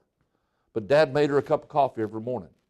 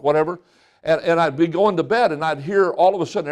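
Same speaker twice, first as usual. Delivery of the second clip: ordinary talk, nothing marked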